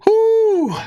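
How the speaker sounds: sudden high delighted whoop; real thrilled whoop at the glow; excited whoop. Real, not acted. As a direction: let out a joyful cowboy holler. Delighted by the light.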